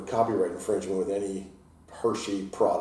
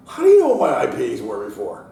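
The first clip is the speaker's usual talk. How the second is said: loud, higher suspicious question; suspicious demand; 5 semitones higher, 12 dB louder than usual. Retold or imitated. Dad questioning his son about the old homebrew, loud. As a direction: demand an answer, half laughing. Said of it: "I'm like".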